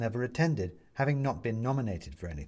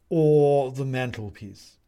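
slow, loud, stretched first word; solemn owl judge adding a pronouncement; 3 semitones higher, 8 dB louder, slower than usual. A narrator's reading.

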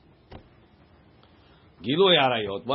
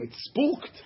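high, alarmed peasant voice; a mock frightened buyer; spooked goy; 11 semitones higher than usual. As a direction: play the scared goy, pitched up.

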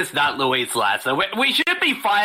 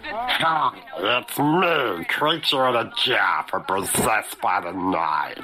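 low, slow spooky monster voice; scary creature voice; 7 semitones lower, much slower than usual. Acted. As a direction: growl it out like a night creature. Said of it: "you're scary".

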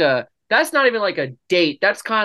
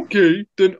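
higher mocking put-on voice; shadow voice; 4 semitones higher, 4 dB louder than usual. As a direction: imitate her, pitched up. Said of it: "why am I doing the shadow voice".

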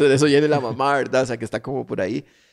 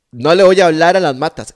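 loud, higher protest; exasperated refusal; 5 semitones higher, 9 dB louder than usual. Retold or imitated. Friend refuses loudly to talk to houseplants, indignant shout. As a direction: bark it out in disbelief.

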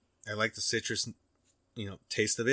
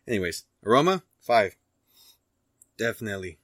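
usual speaking voice

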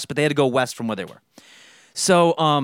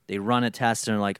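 usual speaking voice

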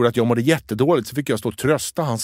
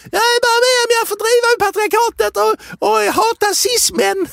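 much higher, prissy parody; mocking high voice. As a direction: squeak it out like a caricature. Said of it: "Och jag bara". (hög, hånfull parodiröst)

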